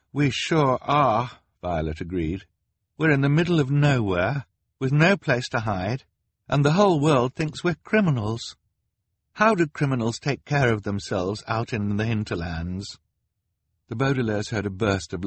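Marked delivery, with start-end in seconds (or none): 0.1-1.3 grim agreement. A narrator's reading. Violet agreeing grimly. 3.0-13.0 anxious. A narrator's reading. Violet despairing.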